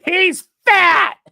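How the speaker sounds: high, very loud heckle; mock yelling. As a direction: yell it out like a heckler, stretching the last word. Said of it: "P P P yelling".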